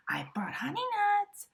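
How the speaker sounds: high, loud, cheery badger voice; grinning badger. A narrator's reading. Badger offering honey nuts to the friends with a grin. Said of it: "Badger says with a grin".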